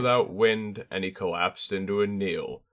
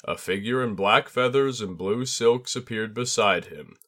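usual speaking voice

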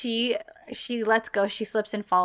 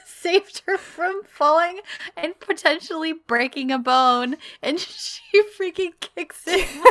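high-pitched words broken by giggles; laughing retelling; 7 semitones higher, 4 dB louder than usual. Real, not acted. Host retelling the shin kick, laughing as she talks. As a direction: giggle through the story.

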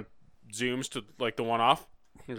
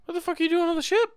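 mock outrage; faster than usual. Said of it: "they're like".

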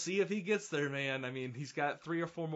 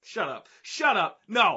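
emphatic objection, louder with each phrase; mounting protest; 6 semitones higher, 10 dB louder than usual. Real, not acted. Co-host cutting off his partner's wrestling talk, mounting protest. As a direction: shut it down, building to a loud, high burst. Disbelieving outburst in banter.

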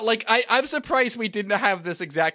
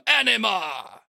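raised theatrical call; summoning cry; 7 semitones higher, 4 dB louder, slower than usual. Acted. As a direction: call the monster forth grandly. Seymour summons his creature.

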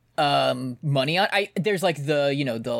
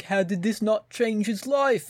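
higher, slow, put-on musician's voice; 6 semitones higher, slower than usual. Podcast host mimicking the guy from Coldplay, slow, higher mock-earnest voice. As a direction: imitate an earnest rock singer, slowly and higher. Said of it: "the guy from Coldplay being like".

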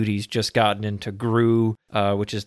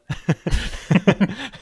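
laughing; 10 semitones higher than usual. Real, not acted. Laughing at the skit.

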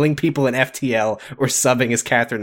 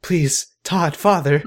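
pleading fans; 6 semitones higher, much slower than usual. Retold or imitated. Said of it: "everybody is like".

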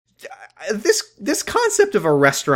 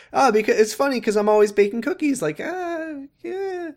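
imitated giddy enthusiasm; 13 semitones higher than usual. Voicing Grace explaining her nickname, giddy enthusiasm. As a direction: squeal it, tickled by your own joke. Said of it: "Grace is so into it".

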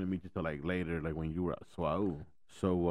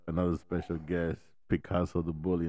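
ordinary delivery

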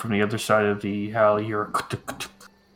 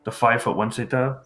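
usual speaking voice

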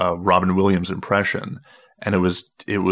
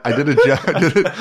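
normal talking voice

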